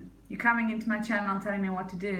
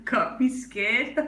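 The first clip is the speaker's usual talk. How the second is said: laughing; 8 semitones higher, 4 dB louder, slower than usual. Real, not acted. Laughing while joking.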